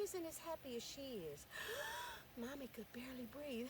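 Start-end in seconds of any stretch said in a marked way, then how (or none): 1.5-2.3 sharp high gasp. Acted. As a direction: catch a breath in shock. Mother unable to breathe.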